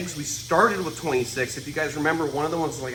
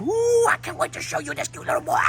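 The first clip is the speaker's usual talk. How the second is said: much higher, cooing sing-song over a pet. Real, not acted. Cooing over a cute snake.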